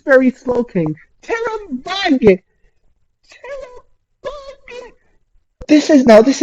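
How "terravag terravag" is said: high, slow, drawn-out cry; stunned wail. Real, not acted. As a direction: stretch the name in disbelief. Shocked at enemy move.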